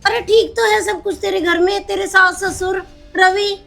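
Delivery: startled mother seeing her daughter's state; shocked mother. Acted. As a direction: gasp at how your daughter looks.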